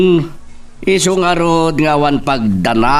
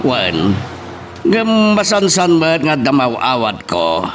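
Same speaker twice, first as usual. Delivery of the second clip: ordinary talk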